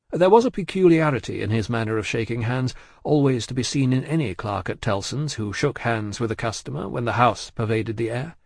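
plain reading voice

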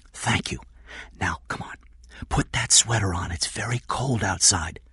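hushed, hurried-along instructions; whispering. Acted. Kathy gets Jeff dressed, whispering. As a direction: whisper gently but urgently.

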